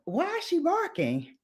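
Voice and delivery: retold high-pitched puzzled question. Storyteller wondering about the barking dog, puzzled. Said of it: "And I was like".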